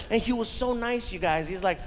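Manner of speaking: usual speaking voice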